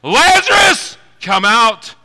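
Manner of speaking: high, loud call, Lazarus stretched out; loud command. Retold or imitated. Jesus calling Lazarus from the tomb, loud shout. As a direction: call the dead man out of the grave. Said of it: "in a loud voice".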